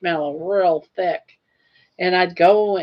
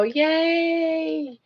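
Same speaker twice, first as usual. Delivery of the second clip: high, sing-song yay from the crowd; mocking a room of cheering dieters; 10 semitones higher than usual. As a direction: chirp it like a fake-happy audience. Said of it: "Everyone go".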